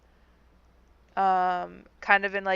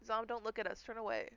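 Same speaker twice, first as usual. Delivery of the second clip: hushed; 3 semitones higher, 13 dB quieter, faster than usual. Real, not acted. Embarrassed crying players hide.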